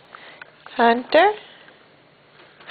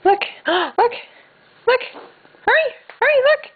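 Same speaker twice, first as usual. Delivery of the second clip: excited calling; 11 semitones higher than usual. Real, not acted. Urging toddler to look.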